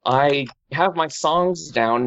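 ordinary delivery

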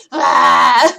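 loud mock yell with a laugh in it; toddler war cry. Mom imitating toddler attack, loud laughing yell. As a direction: shout like a charging toddler. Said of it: "start punching me".

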